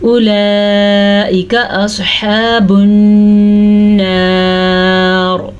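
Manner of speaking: slow drawn-out tajweed recitation; Quran recitation. Shown as a demonstration. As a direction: chant it slowly, stretching the madd. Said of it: "इस मद का ध्यान रखना है". (धीमी तजवीद वाली तिलावत)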